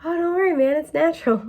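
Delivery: light, easygoing stoner reassurance; laid-back dude. Retold or imitated.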